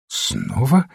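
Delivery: "Снова?" with a rising questioning tune; questioning echo. A narrator's reading. Young man catching the lady's slip, "Снова?" as a rising query. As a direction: echo "Снова?" back, lifting the end. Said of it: "переспросил Мэллоу".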